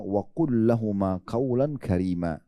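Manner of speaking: slow, measured recitation of the Arabic verse; Quranic recitation. Acted. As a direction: recite it slowly and reverently. Preacher quotes Arabic verse.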